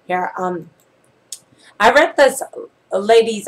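normal talking voice

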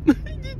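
short high laugh; amused laugh. Real, not acted. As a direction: burst out laughing at yourself. Laughing at himself.

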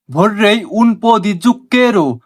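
very slow, word by word Italian phrase; slow teaching demo. Shown as a demonstration. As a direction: spell it out slowly for learners. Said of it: "পুরোটা মিলিয়ে হচ্ছে". (ধীরে ধীরে শেখানোর উচ্চারণ)

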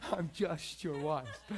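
mock whiny quote through laughter, slowed; laughing retelling. Pastor laughing as he quotes wife's complaint, slow mock. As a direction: laugh while imitating the wife's line.

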